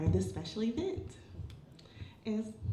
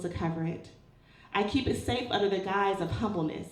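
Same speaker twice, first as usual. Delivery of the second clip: measured poetry recital, louder than casual chat; spoken-word poem; 6 dB louder than usual. Acted. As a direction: recite with deliberate phrasing and pauses. Performs a second poem.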